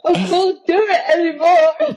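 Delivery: high, drawn-out pretend whimper; mock wailing. Overfull model to photographer, mock tearful protest. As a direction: wail it slowly, hamming up the defeat. Retelling being too full to eat.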